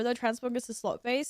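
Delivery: ordinary delivery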